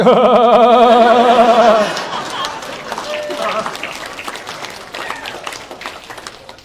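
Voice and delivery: long, loud, high gloating laugh; triumphant laugh. Retold or imitated. Answering the amazed classmates, long triumphant laugh. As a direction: laugh out your victory.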